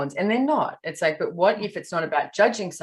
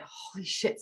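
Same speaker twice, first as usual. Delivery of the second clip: hushed, voiceless and much softer; shaken whisper; 3 semitones higher, 11 dB quieter, slower than usual. Retold or imitated. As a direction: whisper it like he's still in shock. Friend shaken after shark sighting.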